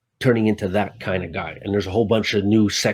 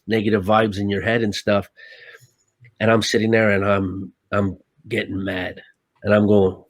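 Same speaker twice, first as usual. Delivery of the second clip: ordinary talk, nothing marked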